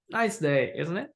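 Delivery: tip isn't it upward, checking for agreement; rising intonation. Shown as a demonstration. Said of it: "rising intonation".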